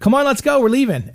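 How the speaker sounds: high, coaxing call to a dog. Retold or imitated. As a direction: imitate summoning a stubborn pet. Acts out calling his dog.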